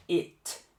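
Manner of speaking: "It" with a short i and a crisp final t, no vowel after it. Shown as a demonstration. Teacher showing students the crisp final T of "It". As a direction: say "It" cleanly and stop on the T. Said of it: "olha pra mim".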